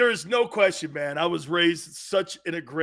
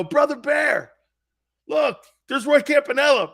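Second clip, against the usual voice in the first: high, loud call to a pal; imitated excited calling; 9 semitones higher, 6 dB louder than usual. Friends point out Campanella to grandfather, excited calling. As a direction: call out a star sighting excitedly.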